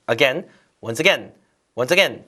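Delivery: "again" each time with a short E, a-GEN. Shown as a demonstration. Teacher drills learners on the phrase, "again" as a-GEN. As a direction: repeat "once again" with "again" rhyming with pen. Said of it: "발음 다시 한번 해보세요".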